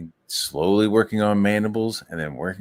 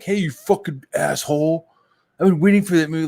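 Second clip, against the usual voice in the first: raised-pitch imitation of an irate fan; hammed-up complaint, voice pushed higher; mock indignant; 8 semitones higher, 4 dB louder than usual. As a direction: play an angry heckler. Imagined angry fan accosting him.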